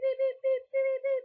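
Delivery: high, loud, short beeps; imitated censor beeps. Vlogger bleeping out her bucket list item, loud high beeps. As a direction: beep like a censor tone.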